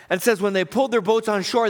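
ordinary talk

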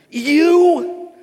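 "You," punched high and loud; emphatic. Acted. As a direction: hit "You," hard and hold it, acting an angry Pilate.

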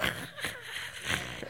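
breathy Darth Vader hiss; imitated coffee machine hiss. Imitating the coffee maker's noise, a breathy hiss. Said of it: "it's like this Darth Vader, loud breathing".

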